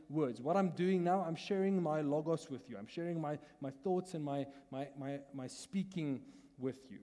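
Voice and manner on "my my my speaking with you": ordinary talk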